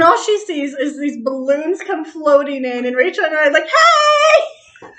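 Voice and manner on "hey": much higher, loud, cheery hey; surprise greeting. Retold or imitated. Walking in with balloons on a friend's birthday, loud excited greeting. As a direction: burst in shouting surprise.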